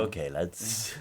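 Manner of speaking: lightly mimicked non-Belfast accent, low and soft; mock non-Belfast accent, said softly; imitated non-Belfast accent. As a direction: quietly imitate an outsider's accent. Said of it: "why they had that accent".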